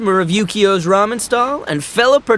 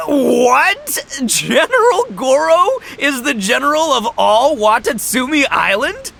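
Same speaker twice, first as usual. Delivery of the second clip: shocked disbelief; 8 semitones higher, slower than usual. Retold or imitated. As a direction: blurt it out like the news floored you. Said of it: "I was like".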